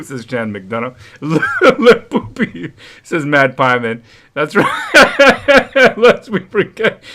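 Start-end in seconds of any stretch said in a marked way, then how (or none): 1.2-7.1 reading through real laughter, higher pitch; laughing. Real, not acted. Host reading viewers' jokes, laughing hard. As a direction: crack up while reading the chat aloud. Reading funny chat comments.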